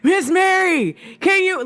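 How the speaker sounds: high, drawn-out call to get attention. Retold or imitated. Stylist calls to client who took hearing aids out, calling out. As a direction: call to someone hard of hearing.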